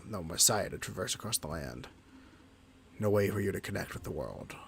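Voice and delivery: low companion voice. Acted. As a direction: voice the lonely companion, low and subdued. Voices Fightsy, lower.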